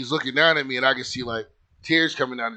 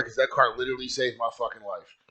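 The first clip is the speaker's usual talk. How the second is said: usual speaking voice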